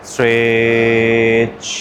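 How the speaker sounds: slow, sustained "streeetch"; drawn-out stretch cue. Shown as a demonstration. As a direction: STRETCH held long on a level tone. Coaching a long body stretch.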